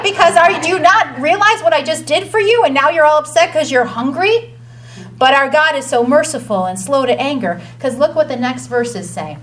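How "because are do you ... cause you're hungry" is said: mock outraged, higher and a touch louder; hammed-up divine indignation; exasperated God. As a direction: scold them like a fed-up deity. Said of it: "if I was God".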